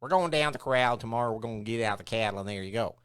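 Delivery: nasal Texas twang; Texas accent. Shown as a demonstration. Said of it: "throw in a Texas accent".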